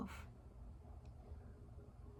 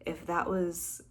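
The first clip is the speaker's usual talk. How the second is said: ordinary talk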